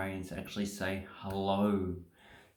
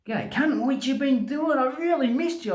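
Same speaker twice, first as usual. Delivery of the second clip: high, louder, excited hello; gushing affection; 13 semitones higher, 6 dB louder than usual. Shown as a demonstration. Greeting a friend with a rude word, high and affectionate.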